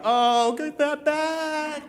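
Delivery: high-pitched, long drawn-out plea; mock panic. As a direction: stretch it out high in comic desperation. Panic after hitting send.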